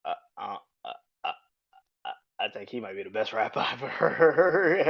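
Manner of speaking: put-on hesitant stammering kid, half laughing; awed eight-year-old. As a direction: play your child self in awe, stammering. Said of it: "And I was like, "Dad," I was like".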